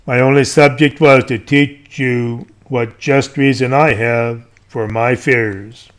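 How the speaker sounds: normal talking voice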